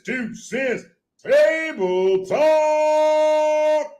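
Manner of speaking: high, stretched-out announcer call; hype show announcer. Acted. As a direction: draw out the show intro in a high voice. Repeats show's opening call.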